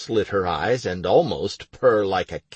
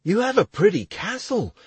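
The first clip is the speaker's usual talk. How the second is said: lightly excited, higher voice; 12 semitones higher, 4 dB louder than usual. Acted. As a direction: say it with happy excitement. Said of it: "Clowder said excitedly".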